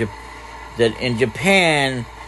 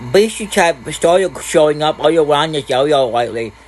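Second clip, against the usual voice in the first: put-on higher, childish boy's voice; small boy telling the village news; little boy; 7 semitones higher than usual. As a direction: put on a young boy's pitched-up voice. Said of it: "Oh, a little boy".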